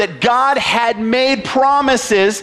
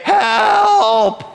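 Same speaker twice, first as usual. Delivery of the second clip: high, long drawn-out cry for help; desperate cry; 11 semitones higher than usual. Acted. Desperate people trusting God to save them, crying out. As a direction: cry out as if for rescue. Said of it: "desperate people who trusted God enough to say".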